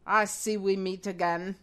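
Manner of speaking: put-on low villain, faint German accent; attempted German villain. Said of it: "That's not German".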